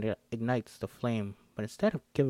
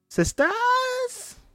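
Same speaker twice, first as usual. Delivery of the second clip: witch voice; 5 dB louder, slower than usual. Acted. Witch returns from dead.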